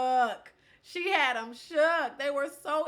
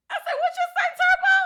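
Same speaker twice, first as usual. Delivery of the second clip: very high, loud mock squeal; hammed-up disbelief; 11 dB louder, faster than usual. Retelling her comeback to Turbo's compliment, squealed mock disbelief. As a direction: squeal it loudly, on the edge of laughter.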